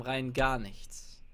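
"Rein gar nichts" stretched slowly, each word lingered on; slow deadpan drawl; drawn out. Acted. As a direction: drag out "gar" for emphasis. Repeats the line twice.

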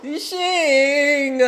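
high, loud, drawn-out cry of the name; exclaiming. Real, not acted. Reacting, calls out singer's name.

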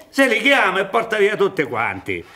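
high laughing mockery of the hen's call; mocking with laughter. Old man mocking letters that lure the young, laughing. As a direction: chuckle through the chick calls. Jacospina mocks letters like hen.

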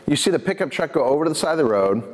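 ordinary talk

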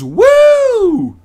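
high, loud party whoop; yelled cheer. A narrator's reading. Cindy up on her bar stool, yelling a cheer. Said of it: "and yells".

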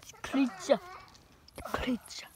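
whispered awe. Acted. Explorer naming the creature in a hushed whisper. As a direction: breathe the word in awe.

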